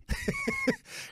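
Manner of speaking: high squealing laugh. Real, not acted. Comedian cracking up at his own ex impression, squealing laugh.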